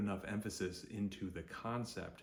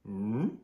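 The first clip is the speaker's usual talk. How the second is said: loud hum sliding upward; puzzled cartoon-dog hum with a rise; Scooby-Doo hum; 8 dB louder than usual. Teacher recaps Scooby-Doo tone, puzzled rising hum. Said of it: "Second tone is the Scooby-Doo tone".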